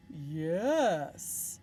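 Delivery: smug dragon yes; proud dragon voice. Acted. Dragon smugly agreeing with Elizabeth. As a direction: puff up like a vain dragon.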